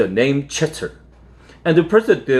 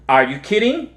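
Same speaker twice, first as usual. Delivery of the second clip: put-on 1 incredulous kid; 9 semitones higher than usual. A narrator's reading. Kid protesting to dad.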